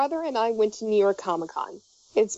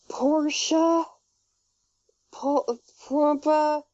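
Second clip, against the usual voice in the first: high, slow, halting Pooh voice; higher-pitched, hesitant storybook-bear impression; tired Winnie the Pooh; 6 semitones higher, much slower than usual. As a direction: sound out unfamiliar names as a weary cartoon bear. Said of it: "he's, like, really tired".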